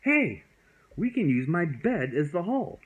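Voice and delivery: high, loud, excited call; cried out. Acted. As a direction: shout the idea with joy. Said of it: "he cried out".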